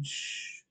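long whispered shh; shushing. Acted. Cutting someone off mid-conversation, shushing. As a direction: hush them with a drawn-out shh.

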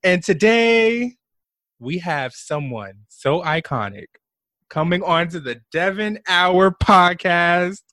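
loud, high-pitched show-opening host voice; big podcast host intro, stretching the key words. Pretend. Host opening the Devon Hour for listeners, podcast voice. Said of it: "Let me put on my podcast voice".

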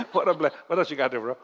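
normal talking voice

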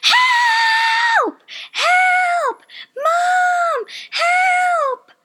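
high, loud cries calling for mom; panicked yell for help. Retold or imitated. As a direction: scream for help as if trapped in the dream. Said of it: "imagining my head, yelling".

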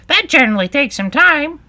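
high, loud interjection; Griffin interrupting. A narrator's reading. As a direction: cut in loudly in a high creature voice. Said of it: "interrupted the Griffin".

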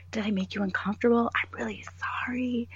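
whispered, quick apology; mock overly nice coworker whispering sorry; apologetic whisper. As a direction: whisper it sheepishly. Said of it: "kinda apologetic".

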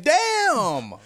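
high, loud "damn" of mock shock; big retold burst of amazement; amazed reaction. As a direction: exclaim it loudly, mock-astonished at so many options. Acted amazed reaction to options.

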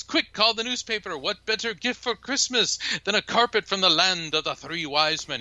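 high put-on voice, excited; bubbling with delight; [name]'s voice. Boss joking to lawyer, high excited put-on voice. [name] excited about joke.

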